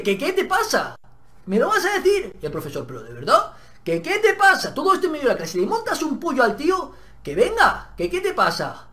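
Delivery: high, loud challenge; angry confrontation. Retold or imitated. Student confronts the bully before the class, loud and angry. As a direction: keep pushing him in front of everyone.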